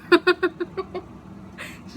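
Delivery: breathy giggle fit; helpless silent giggles; laughing. Real, not acted. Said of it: "I can't stop laughing".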